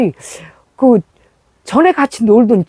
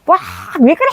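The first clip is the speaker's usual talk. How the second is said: retold yell for help; 7 semitones higher, faster than usual. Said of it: "그러면 소리를 질렀어요".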